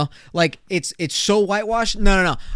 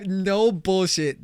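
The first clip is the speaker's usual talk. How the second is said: usual speaking voice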